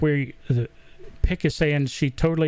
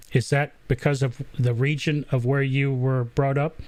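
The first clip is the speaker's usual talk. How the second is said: ordinary talk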